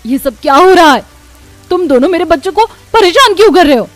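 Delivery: loud, high, rapid-fire demand; angry confrontation. Acted. Mother confronting children who bullied hers, angry and loud. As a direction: burst in shouting, protective of your children.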